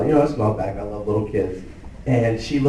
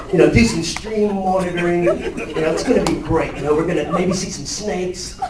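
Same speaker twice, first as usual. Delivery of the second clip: put-on Australian accent, buzzing with enthusiasm, pitched up high; Steve Irwin impression; 6 semitones higher than usual. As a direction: go full excited Aussie wildlife presenter. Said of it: "just talking like".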